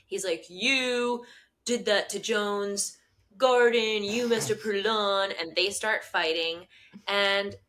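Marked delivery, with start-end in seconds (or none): 0.5-5.3 slow, drawn-out mock accusation; accusatory. John confronting Gabby over the garden, mock accusatory. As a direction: point the finger, mock-dramatically. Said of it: "he's super accusatory".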